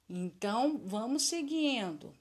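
ordinary talk

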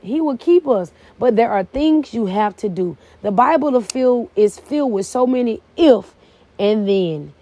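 usual speaking voice

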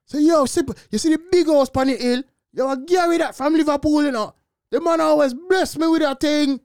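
loud, boastful yardie patois; Jamaican accent. Acted. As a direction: brag it big in Jamaican slang.

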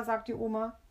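usual speaking voice

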